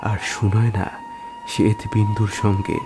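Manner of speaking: ordinary delivery, nothing marked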